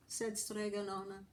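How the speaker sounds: plain reading voice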